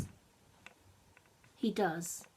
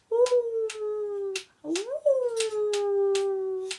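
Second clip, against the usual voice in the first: long high howling ooh; wolf howl; 13 semitones higher, 5 dB louder than usual. Acted. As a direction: throw your head back and howl like a wolf cub. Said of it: "put his head up high".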